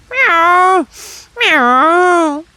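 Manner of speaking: high, loud cat mewing; cat crying out at the shop door; cat crying meow. A narrator's reading. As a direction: cry out like a hungry cat. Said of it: "cried Simpkin".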